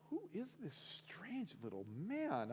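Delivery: high, soft, incredulous wondering; a baffled aside to yourself, high and hushed; bewildered. Retold or imitated. Man wondering about the stranger on his couch, bewildered.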